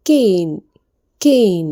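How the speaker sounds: കെയിൻ said as "kane", long ay sound, repeated slowly; pronunciation demo. Shown as a demonstration. Teacher shows students how to say കെയിൻ, carefully and clearly. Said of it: "കെയിൻ എന്നാണിത് ഉച്ചരിക്കേണ്ടത്".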